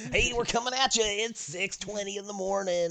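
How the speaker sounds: morning zoo DJ. Retold or imitated. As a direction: greet the commuters like a wacky DJ. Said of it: "the morning, the morning zoo".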